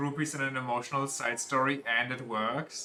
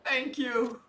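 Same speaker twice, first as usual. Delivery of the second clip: put-on a small boy's grateful thank-you, much higher; 10 semitones higher than usual. Boy thanking the stranger who helped him, high kid voice. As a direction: say it as a little kid, sweet and high.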